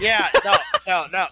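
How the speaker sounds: sudden loud burst of no, no; real loud objection to the cohost; loud protest. Real, not acted. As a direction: cut in hard, high and loud. Cohost protests loudly, overlapping.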